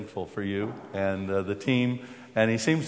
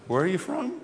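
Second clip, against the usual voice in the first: pitched higher, rising, mildly incredulous; puzzled repeat; 9 semitones higher than usual. Retold or imitated. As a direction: ask again as if you misheard. I look.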